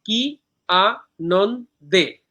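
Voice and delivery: very slow কি, আ, নন, দে; "কি আনন্দে" split into four separate beats; syllable by syllable. Shown as a demonstration. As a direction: pause after every syllable of "কি আনন্দে". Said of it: "এইখানে চার মাত্রা একটা পর্ব".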